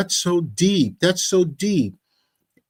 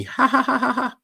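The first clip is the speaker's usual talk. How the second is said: mock laugh; 9 semitones higher than usual. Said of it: "Emoji funny".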